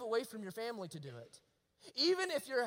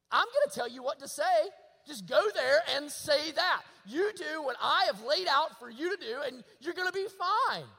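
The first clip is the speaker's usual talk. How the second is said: emphatic promise; 5 semitones higher, 6 dB louder than usual. Retold or imitated. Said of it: "He said".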